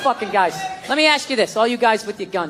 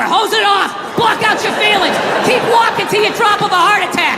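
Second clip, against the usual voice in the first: spiral into manly panic, pitched way up; panicked macho rant; 10 semitones higher than usual. Retold or imitated. Man in the bath ranting to himself, high and panicky. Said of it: "It's like".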